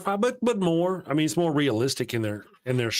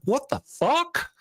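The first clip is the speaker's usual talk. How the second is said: much higher, slow, stretched-out what the fuck; shocked disbelief; 13 semitones higher, slower than usual. Retold or imitated. Watching Pike pray, he reacts in high, slow shock. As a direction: gape at the screen in disbelief. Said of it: "I'm like".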